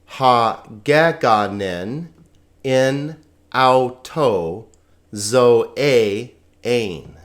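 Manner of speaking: "Ha geganin in auto zoe ein" slow and deliberate, joined up; slow model reading. Shown as a demonstration. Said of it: "read that all together now".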